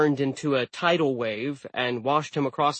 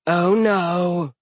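loud, higher villainous snarl; 5 semitones higher, 6 dB louder than usual. A narrator's reading. Giantess seizing her prey by the leg, snarling. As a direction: menace them with a drawn-out snarl. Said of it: "she snarled".

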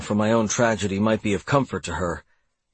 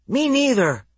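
put-on very high small boy; little boy voice. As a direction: chirp it like a tiny boy. Little brother Riley chimes in.

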